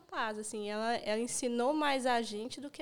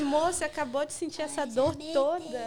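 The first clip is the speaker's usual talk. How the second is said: laughing; 5 semitones higher, 4 dB louder than usual. Retold or imitated. Retelling her reply to wife, laughing.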